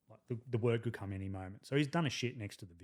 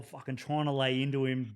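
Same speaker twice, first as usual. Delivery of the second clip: ordinary talk, nothing marked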